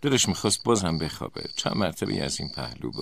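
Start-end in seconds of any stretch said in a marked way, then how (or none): none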